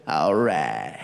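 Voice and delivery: low laid-back Texan drawl; McConaughey impression. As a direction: drop deep into a lazy Texan drawl. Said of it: "I hope his accent's the same".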